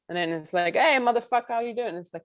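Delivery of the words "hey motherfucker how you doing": higher-pitched mock-friendly hello; casual sweary greeting. Imitating film English, a casual high-pitched greeting. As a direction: toss out a movie-style greeting, pitched up.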